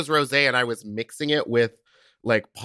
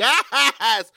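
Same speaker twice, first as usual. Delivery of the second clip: excited yes; 7 semitones higher, 4 dB louder than usual. Retold or imitated. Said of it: "I was like".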